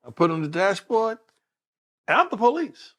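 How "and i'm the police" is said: calling out. Retold or imitated. Announcing himself to the officers.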